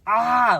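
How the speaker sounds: imitated scream, not the girly kind; mock scream. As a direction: yell out a full-voiced pain cry. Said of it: "but not like that".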